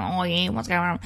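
hammed-up Aussie background chatter; mock a broad Australian accent. Host imitating Aussies at the wedding barbecue, broad Aussie accent. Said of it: "hear some Aussie accents going".

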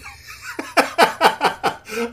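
high-pitched giggling fit; helpless high laugh; real laughter. Real, not acted. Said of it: "I'm laughing because".

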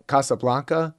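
Casablanca with "blahn" in the middle; habitual pronunciation. Shown as a demonstration. Host shows how he has always heard it, habitual pronunciation. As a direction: open "ah" in the "blanc" of Casablanca. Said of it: "I've always heard it pronounced".

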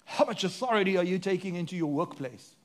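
normal talking voice

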